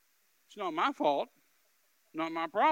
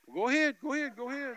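higher, quick retort in another man's voice; raised-pitch mock dare; dismissive retort; 8 semitones higher, 6 dB louder, faster than usual. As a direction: act out a man daring him on, starting loud.

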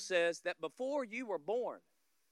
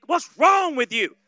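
mock yell; 6 semitones higher, 13 dB louder than usual. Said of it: "You would yell at the".

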